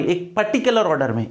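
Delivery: usual speaking voice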